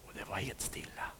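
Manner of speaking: low, hushed whisper. A narrator's reading. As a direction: whisper it very still. Total stillness.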